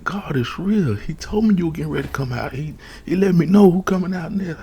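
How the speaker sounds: high-pitched, elated retelling, building; growing excitement. Retold or imitated. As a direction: relive the amazement, pitch climbing as it builds. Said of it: "So I'm getting more excited, more excited".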